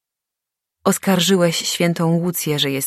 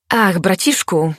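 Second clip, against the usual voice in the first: mild mock shout to a brother, higher and louder; breathless shout; 4 semitones higher, 5 dB louder than usual. A narrator's reading. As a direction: call out tauntingly, raised but restrained. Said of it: "krzyknął zziajany".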